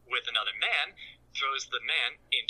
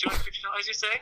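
hammed-up eager buyer, voice leaping higher; mock surprise; 8 semitones higher, faster than usual. Man in the closet suddenly agreeing to the price, mock surprise. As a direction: pitch jumps up, suddenly interested.

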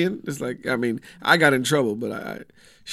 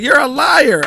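much higher and louder mock yell; imitating a furious little daughter; retold shout; 12 semitones higher, 12 dB louder, slower than usual. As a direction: shriek it back at mom. Daughter arguing with her mother.

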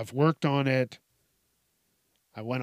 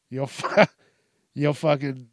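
ordinary talk

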